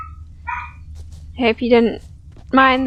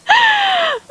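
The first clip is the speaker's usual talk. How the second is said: very high laughing shriek. Real, not acted. Player laughing at getting carried away. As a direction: let out a squealing laugh.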